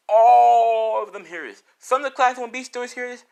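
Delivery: big, high-pitched insistent narration; emphatic. A narrator's reading. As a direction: raise the voice to drive it home. Narrator stresses everyone overhears it.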